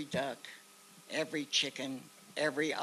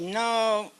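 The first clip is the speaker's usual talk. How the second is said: loud, higher drawn-out no; retold refusal; 8 semitones higher, 8 dB louder than usual. Base soldier doubting the stranger, retold loud refusal. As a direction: deny it loudly, pitched up.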